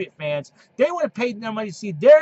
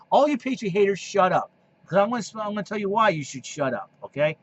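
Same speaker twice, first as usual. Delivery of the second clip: ordinary delivery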